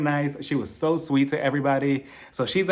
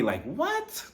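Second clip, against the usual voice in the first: incredulous; 9 semitones higher, 6 dB quieter than usual. Real, not acted. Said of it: "disbelief at Kyle".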